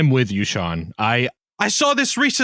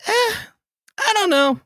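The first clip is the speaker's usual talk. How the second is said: noncommittal shrug; 13 semitones higher than usual. Retold or imitated. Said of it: "I'm just like".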